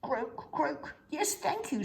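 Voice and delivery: high croaks and thanks; Mister Frog, polite. Acted.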